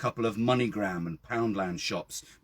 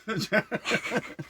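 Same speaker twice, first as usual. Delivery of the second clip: giggle; 12 semitones higher than usual. Real, not acted. Still laughing on the restart.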